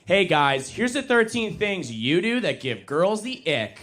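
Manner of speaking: mock a dating-advice video, drawn out and punched up; social media influencer. Woman lecturing guys to her phone, mock influencer voice. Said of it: "beautiful women with their phones in front".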